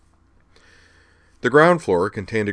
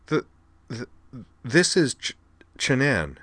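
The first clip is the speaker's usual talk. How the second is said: higher voice, catching on first sounds; stammering; 6 semitones higher, slower than usual. A narrator's reading. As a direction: stumble and restart through the introduction. Kate's stutter voiced in story.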